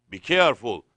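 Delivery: loud, forceful caution; emphatic warning. Retold or imitated. Someone warning Harun on the throne, loud and emphatic. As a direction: drive the warning home loudly.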